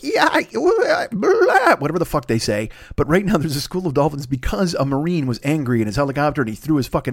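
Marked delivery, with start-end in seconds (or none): 0.0-1.8 high squealing dolphin chatter; imitated dolphin noise. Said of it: "they usually, they're like".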